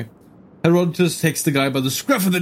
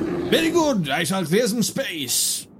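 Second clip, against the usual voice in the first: formal gentleman voice; 7 semitones higher, slower than usual. Acted. Herodotus replies in character.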